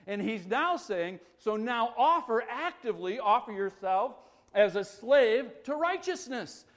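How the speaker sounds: loud, high, forceful sermon delivery; impassioned preaching. Real, not acted. Preacher urging the congregation to offer themselves to righteousness, impassioned preaching.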